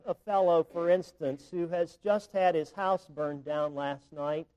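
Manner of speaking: ordinary talk, nothing marked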